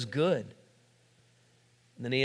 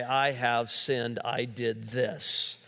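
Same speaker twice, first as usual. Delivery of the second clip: slow and soft, each word weighed; slow sincere confession; 4 semitones lower, 4 dB quieter, much slower than usual. Shown as a demonstration. Preacher modelling a confession to God, very slow and soft. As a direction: own it slowly and quietly.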